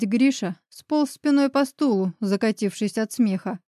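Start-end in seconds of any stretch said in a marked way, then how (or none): none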